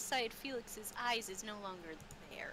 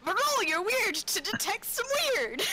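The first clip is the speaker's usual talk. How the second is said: louder and higher, grinning at the table; excited joking; 10 semitones higher, 8 dB louder than usual. Real, not acted. Game master teasing the player, loudly amused. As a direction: announce the silly roll with glee.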